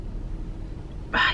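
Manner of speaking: breathy sigh. Real, not acted. Contented sigh after drinking.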